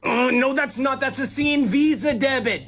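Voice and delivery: high-pitched, excited rush of explaining; over-excited correction. Real, not acted. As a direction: set them straight, getting carried away. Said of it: "He's very fucking excited about those points".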